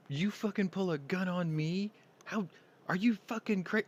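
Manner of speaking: high-pitched imitation of an indignant woman; outraged challenge. Woman confronting the man who pulled a gun, outraged.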